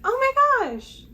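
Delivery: loud, very high squeal; excited squeal. Real, not acted. Reader recognizing the author, loud high squeal.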